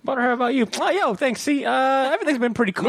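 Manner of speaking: high squeaky mock voice of a friend; mocking impersonation. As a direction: squeak it out, teasing him. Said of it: "Butter doing a little voice".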